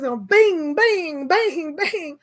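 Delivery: a mock phone chime, "bing" punched out repeatedly; imitating the ping of incoming texts; phone notification bings. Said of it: "And my phone was like".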